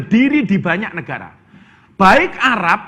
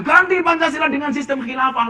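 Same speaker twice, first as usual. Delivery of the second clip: mock protest shout; 7 semitones higher than usual. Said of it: "yang teriak-teriak".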